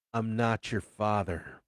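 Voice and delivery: ordinary delivery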